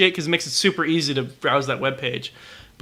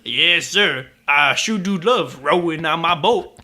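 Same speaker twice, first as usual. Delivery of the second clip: lightly voiced eager servant; a hint of a cheerful Southern servant; mock old-timey servant. Mocking Song of the South, lightly voiced servant.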